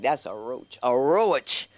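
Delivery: low-pitched matter-of-fact verdict. Retold or imitated.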